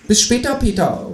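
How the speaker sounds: high-pitched farewell call; kangaroo calling out. A narrator's reading. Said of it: "ruft das Teutelbier".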